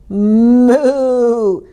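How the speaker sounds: long, drawn-out moo; cow bellow. A narrator's reading. Cow joining the noisy stable, long bellowed moo. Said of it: "bellowed Cow".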